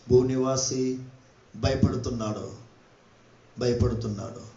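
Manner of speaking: very low, soft statement with pauses; hushed solemnity. Real, not acted. Preacher telling congregation that earth's people fear, hushed and solemn. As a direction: let it sink away gravely. Sober restatement after the climax.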